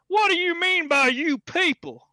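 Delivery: high, loud, mock-offended comeback; mock indignant retort. Imagined comeback to the man who said you people, mock outrage. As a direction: play it up as if truly insulted. Wished retort to racist remark.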